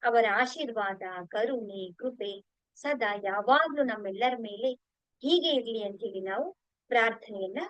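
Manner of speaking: slow, measured devotional recitation; unhurried litany of gratitude; prayer of thanks. Real, not acted. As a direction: recite each thanks reverently, endings drawn out.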